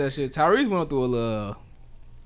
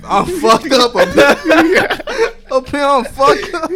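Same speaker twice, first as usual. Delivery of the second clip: mock crying; 13 semitones higher, 8 dB louder than usual. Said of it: "I'm talking like I'm".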